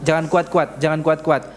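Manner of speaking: ordinary talk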